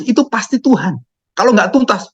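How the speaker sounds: usual speaking voice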